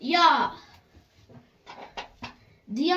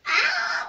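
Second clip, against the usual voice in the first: yelp. Real, not acted. Brush hits her ear.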